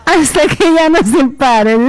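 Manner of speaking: cheeky joke through laughter; laughing. Real, not acted. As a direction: squeeze the words out while laughing. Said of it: "Van a disculpar que yo me ría así".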